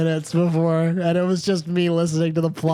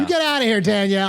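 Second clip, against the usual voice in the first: higher-pitched mock dismissal; mock banishing; 5 semitones higher, faster than usual. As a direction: playfully shoo someone out.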